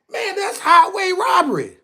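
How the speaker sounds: high-pitched indignant shout; outraged cry. Real, not acted. Streamer ranting to viewers about tariffs, outraged cry.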